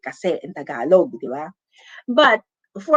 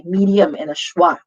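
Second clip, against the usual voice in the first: usual speaking voice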